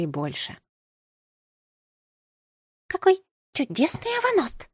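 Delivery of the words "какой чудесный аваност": high chirpy little bird voice; cartoon princess. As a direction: chirp it out like a sweet titmouse. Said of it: "прощебетала миловидная синичка". (звонкий щебечущий птичий голосок)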